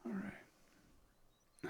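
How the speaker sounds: a soft, drawn-out whisper; barely-there bedside whisper; hushed whisper. Acted. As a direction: breathe it out gently as you settle him. Tucking him into bed.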